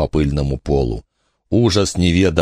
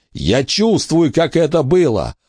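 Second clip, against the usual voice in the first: high, slow, pained cries; anguished pleading; 11 semitones higher, slower than usual. A narrator's reading. Billy in pain begs for calm.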